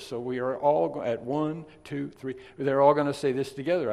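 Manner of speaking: ordinary delivery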